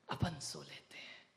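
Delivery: whispered under the breath; sly whisper. Acted. Gardener plotting to nap on the king's bed, whispering. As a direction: murmur it like a secret no one should hear.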